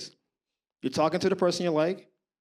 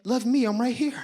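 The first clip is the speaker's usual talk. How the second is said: pleading; 4 semitones higher than usual. Retold or imitated. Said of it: "I'm like".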